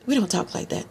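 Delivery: softly, breaking into laughter; quiet and giggly; amused denial. Real, not acted. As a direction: say it through a laugh. Joking denial after mock accent.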